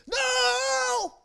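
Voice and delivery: high, loud sudden shout of warning; driver's shout. Retold or imitated. Bus driver shouting at the preacher to stop. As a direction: yell no to stop him stepping. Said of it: "the bus driver says".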